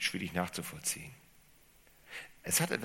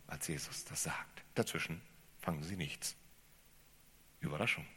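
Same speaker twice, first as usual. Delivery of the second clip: leise, fast geflüstert; Flüstern; 3 semitones lower, 8 dB quieter than usual. Real, not acted. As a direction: senk die Stimme zum Flüstern. Hushed emphasis point. (leise geflüsterte Pointe)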